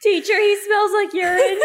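high laughing tattling; laughing tattle. Acted.

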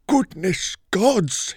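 loud, high, drawn-out bellow; shocked bellow. A narrator's reading. Stepson sees the wrecked tower room, bellowing. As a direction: bellow it slowly in horrified surprise. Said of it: "he bellowed".

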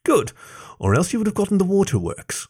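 high-pitched, pleased mock threat; playful warning. A narrator's reading. Fox warns Jason of coming waterworks, playfully smug. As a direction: lift it high, cutely triumphant.

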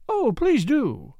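high, slow, drawn-out eager plea; eager cry. A narrator's reading. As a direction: beg him keenly in a high woman's voice. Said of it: "cried Flora".

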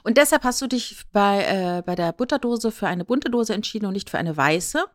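ordinary talk, nothing marked

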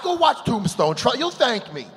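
usual speaking voice

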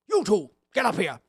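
lightly voiced bossy Grinch; commanding. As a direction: call the others up with mild authority. Grinch orders the others up.

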